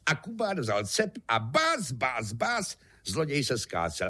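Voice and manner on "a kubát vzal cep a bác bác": plain reading voice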